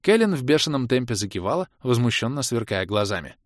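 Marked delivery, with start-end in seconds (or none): none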